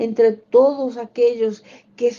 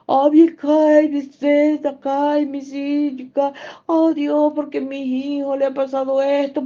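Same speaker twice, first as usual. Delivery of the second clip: raised ecstatic sermon voice; ecstatic prayer; 4 semitones higher than usual. Acted. Tongues and lament.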